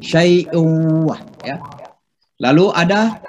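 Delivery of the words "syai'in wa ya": syai'in wa, the n merged into the w with a nasal hum; idgham demo. Shown as a demonstration. Teacher demonstrating idgham bighunnah slowly. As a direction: run syai'in straight into wa, no n sound. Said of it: "Jadi suara N masuk ke waw".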